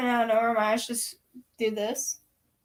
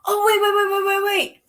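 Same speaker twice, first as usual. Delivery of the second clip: excited; 7 semitones higher, 5 dB louder than usual. Real, not acted. Excited interruption.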